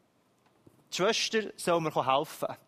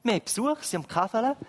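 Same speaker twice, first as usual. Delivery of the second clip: ordinary talk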